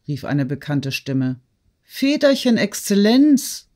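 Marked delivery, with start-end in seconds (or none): 1.8-3.7 high, slow amazed call; astonished cry. A narrator's reading. Steward astonished to meet his young master, surprised cry. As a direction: cry out in sudden recognition. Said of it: "rief er, mit Erstaunen".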